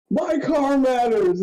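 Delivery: high, slow, hammed-up protest; mock indignant cry. Driver yelling about his falling-apart car, mock outrage. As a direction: wail it like your wreck is precious. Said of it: "and you're like".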